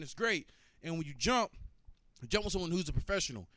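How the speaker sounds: ordinary delivery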